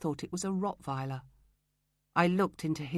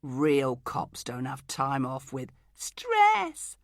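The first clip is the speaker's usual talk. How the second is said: ridiculous high-pitched voice; 3 semitones lower, 4 dB louder, much slower than usual. Acted. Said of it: "said it in a ridiculous high-pitched voice".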